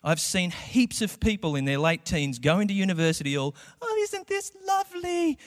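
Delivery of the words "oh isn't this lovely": high, dreamy naive voice; mock innocent delight; naive teen. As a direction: gush like a wide-eyed freshman.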